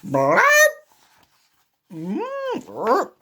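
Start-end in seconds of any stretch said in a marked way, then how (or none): none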